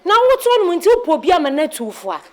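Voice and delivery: high, loud tirade; angry scolding. Acted. Scolding a weeping woman, angry tirade. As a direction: scold at full voice, pitch riding high.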